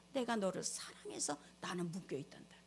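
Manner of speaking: low hushed whisper, stress on 내가; soft whisper, God speaking; whispered love. Acted. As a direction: whisper it as God's confession of love. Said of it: "하나님의 음성이 들려요".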